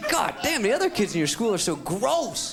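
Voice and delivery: high, exaggerated complaint; disgusted grandma. Grandma scorning the other schoolkids, high exaggerated disgust. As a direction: lean hard on the last word in disgust.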